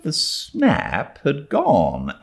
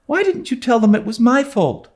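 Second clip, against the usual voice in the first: high, fast, urgent demand; demanding girl; faster than usual. A narrator's reading. Anne to Diana, demanding quickly. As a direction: press her friend for an answer. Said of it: "demanded Anne".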